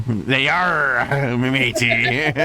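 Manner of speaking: giggling through a pirate line; pirate talk while cracking up; laughing pirate voice. Pretend. Echoes pirate joke laughing.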